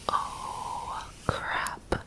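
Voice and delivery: usual speaking voice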